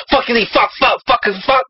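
high-pitched string of random curses; mock cursing. Friend mocking how characters throw curse words, mock cursing. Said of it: "throwing around random curse words".

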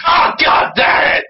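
drawn-out cry of pain; pained curse. Acted. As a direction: curse slowly through a burst of pain. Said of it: "He gasped in pain".

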